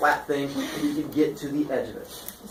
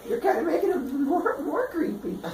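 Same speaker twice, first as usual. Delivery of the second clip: high-pitched mock unease; hammed-up nervousness; creeped out; 12 semitones higher, 4 dB quieter than usual. Father voiced, creeped out.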